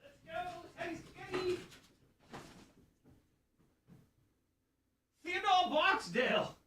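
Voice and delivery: high sing-song call to the dog. Real, not acted. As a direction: call him in a high excited voice. Calling the dog from afar.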